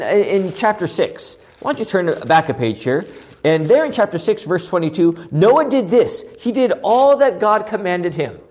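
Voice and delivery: ordinary delivery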